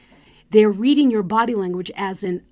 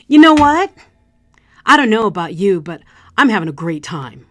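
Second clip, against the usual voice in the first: loud, forced cheer that sounds fake; insincere enthusiasm; 12 dB louder than usual. Shown as a demonstration. Speaker to girlfriend, faking a great time, loud forced cheer. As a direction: pretend you're having fun when you're not. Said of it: "lying about having a good time".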